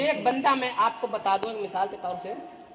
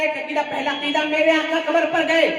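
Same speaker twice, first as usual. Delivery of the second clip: loud, sustained sermon declamation; full-force preaching; 4 semitones higher, 4 dB louder than usual. Real, not acted. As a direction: proclaim it to the whole congregation. (पूरे जोश में बुलंद तक़रीर)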